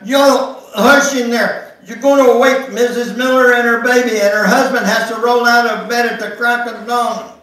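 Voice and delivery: loud scolding yell through a wall. A narrator's reading. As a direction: yell at the kids to hush. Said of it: "she yelled back there".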